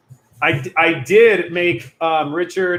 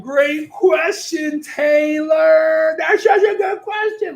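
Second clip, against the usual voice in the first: very high, drawn-out cheer; high, stretched singsong compliment; hammed-up praise; 11 semitones higher, much slower than usual. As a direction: praise it in a silly, sky-high voice. Over-the-top praise of viewer's question.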